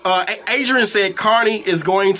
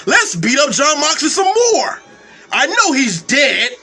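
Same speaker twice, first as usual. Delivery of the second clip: higher mock-eager voice; sarcastic, hammed-up suggestion; mocking quote; 5 semitones higher than usual. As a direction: imitate someone proud of a dumb idea. Mocking wrestlers' supposedly smart idea.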